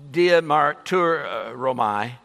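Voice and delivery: diamarturomai sounded out slowly, syllable by syllable; drawn-out diamarturomai, each syllable clear; slow careful diamarturomai; careful Greek word. Shown as a demonstration. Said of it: "It's an intense word".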